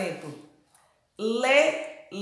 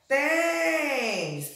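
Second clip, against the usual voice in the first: "Tênis" with TÊ drawn out and higher; calling out the word; 4 semitones higher than usual. Shown as a demonstration. Teacher shows pupils the first syllable of "Tênis", calling out. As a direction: stretch the first syllable of "Tênis", then fall. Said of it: "Chamar essa palavra".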